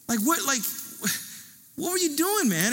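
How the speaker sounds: high mock scold; mad dad. Father scolds the boy who drove the car, high mock anger. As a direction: bark it high like an exasperated dad. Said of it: "I remember how mad he was".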